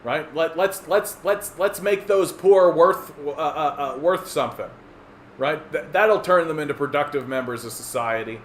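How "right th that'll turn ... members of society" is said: ordinary talk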